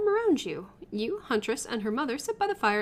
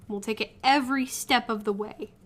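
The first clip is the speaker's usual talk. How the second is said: ordinary talk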